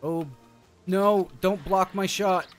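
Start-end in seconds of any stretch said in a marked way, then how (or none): none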